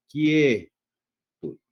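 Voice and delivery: "quietud" slowly, swelling loud on the E of "quie"; stressed open vowel. Shown as a demonstration. Teacher showing the diphthong, E stretched and loud. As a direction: stretch and punch "quie" in "quietud", then drop. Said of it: "se incrementa hacia una vocal abierta".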